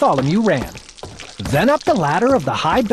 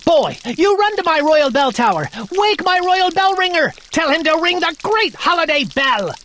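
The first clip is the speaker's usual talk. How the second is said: much higher, bossy royal orders; king ordering his page; commanding king; 11 semitones higher than usual. Acted. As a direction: order the boy about.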